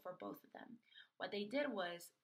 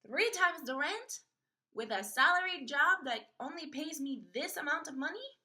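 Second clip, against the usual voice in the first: incredulous outburst; 6 semitones higher, 10 dB louder than usual. Retold or imitated. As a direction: throw the words back in disbelief. Outraged at rent requirement.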